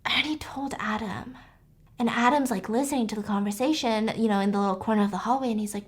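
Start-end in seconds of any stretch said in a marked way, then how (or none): 0.0-1.4 hushed whisper. Retold or imitated. Ex-husband telling the mother he already told Adam, whispering. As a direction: whisper it so the boy can't hear.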